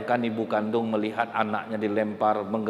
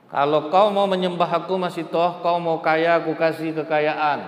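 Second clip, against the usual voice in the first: loud, lofty king offering riches; a preacher's lightly voiced Pharaoh, loud and coaxing; tempting Pharaoh; 5 semitones higher, 4 dB louder than usual. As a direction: lure her with gold like a proud tyrant.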